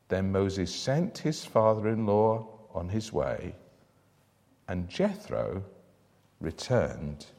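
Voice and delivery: low, measured Bible reading voice; scripture reading. Acted. Preacher reading Exodus aloud to the congregation, solemn and measured.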